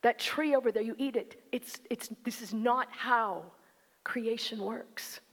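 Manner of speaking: soft, gentle fatherly explanation; loving father. Shown as a demonstration. Preacher showing God's tone as a loving father, soft. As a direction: explain it tenderly like a caring dad. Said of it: "This is the tone of a loving father saying".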